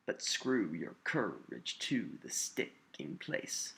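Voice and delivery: slow weak-STRONG beat on "But screw your courage"; iambic stress pattern. Shown as a demonstration. Teacher shows lines built with iambs, slowly stressing every second syllable. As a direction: stress SCREW, COUR, TO, STICK, PLACE, drawn out. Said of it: "These lines are built with iambs".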